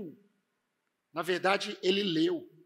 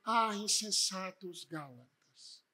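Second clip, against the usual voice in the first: slow, soft, deliberately tame rebuke; mock unemphatic; 2 semitones lower, 7 dB quieter, slower than usual. Preacher showing how Paul would not have scolded the Galatians, mock mild. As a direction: drain all the force from the rebuke, drawing it out softly. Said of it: "Paulo não escreveu a-, aos Gálatas dizendo assim".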